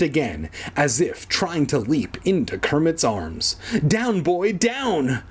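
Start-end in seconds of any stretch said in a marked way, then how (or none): 3.9-5.2 mild mock squeal, pitched up; higher-pitched character plea. A narrator's reading. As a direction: squeal it lightly at a dog. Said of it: "Kermit squealed".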